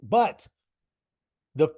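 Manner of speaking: ordinary talk, nothing marked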